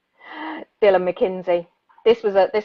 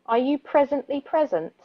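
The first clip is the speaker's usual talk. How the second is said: measured, thoughtful poetry reading; poem reading; 3 dB quieter than usual. Acted. Radio host reads a reflective poem aloud, poetry voice. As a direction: read each question slowly like a poem.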